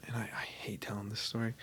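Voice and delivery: whispered aside, half to himself; embarrassed whisper. Real, not acted. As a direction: drop to a sheepish whisper. Embarrassed aside mid story.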